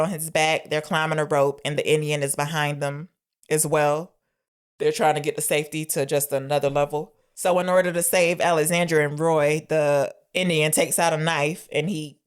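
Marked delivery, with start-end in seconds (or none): none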